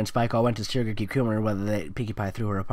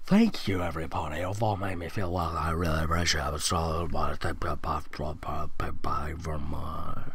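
lower, softer, fading into a whisper; soft tearful thanks; 9 semitones lower, 6 dB quieter than usual. Acted. Sunset thanking every pony, soft and low, nearly whispering. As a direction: murmur your thanks as if moved to tears.

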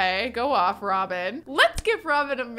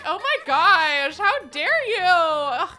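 higher, louder, slow playful mock outrage; mock scandalized aunt; 8 semitones higher, 6 dB louder, slower than usual. Gamer voicing the teasing aunt in mock shock. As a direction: ham up the aunt's fake offense. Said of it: "She's like".

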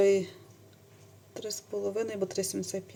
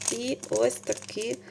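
usual speaking voice

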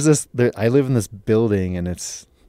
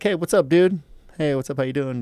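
higher-pitched, easygoing hello; casual friendly greeting; 6 semitones higher than usual. Retold or imitated. Greeting a neighbor in the elevator, casual and friendly. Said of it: "said that kinda quiet or slurred".

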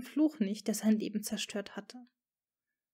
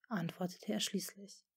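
ordinary delivery

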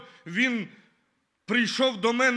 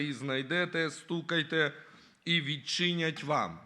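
scripture reading; 3 semitones lower than usual. Acted. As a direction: read the verses solemnly to the church. (спокійне читання Писання)

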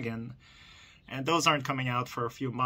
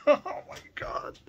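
laughing; 12 semitones higher, slower than usual. Real, not acted. Unboxing a rare chase car.

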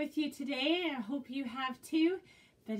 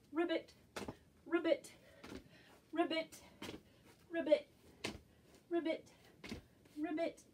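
higher ribbit, ribbit in a hopping beat; frog croaks; 5 semitones higher than usual. Acted. Teacher croaking ribbit as a frog for children. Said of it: "jump like a frog".

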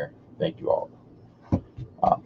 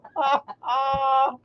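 high laugh; 5 dB quieter than usual. Real, not acted. Laughing at own joke.

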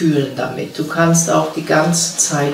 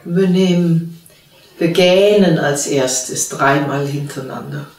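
calm, unhurried meditation voice with long pauses; guided meditation. Real, not acted. (ruhige Stimme einer geführten Meditation, mit langen Pausen)

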